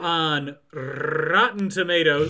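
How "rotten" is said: "Rotten" with a long rolled R; roared R. Acted. Host reading out the review score, roaring the R in "Rotten". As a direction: roll the R at the start of "Rotten" like a roar. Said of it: "That's a good roar".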